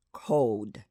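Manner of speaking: cold with the final ld sounded; mainstream cold. Shown as a demonstration. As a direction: say cold ending on a clear d. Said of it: "pronounced as in the following words".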